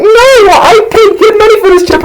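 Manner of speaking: very high mock-outraged yell; outraged friend. Homie paid good money for chip, mock outrage. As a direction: shriek the refusal in falsetto. Friend refuses in acted quarrel.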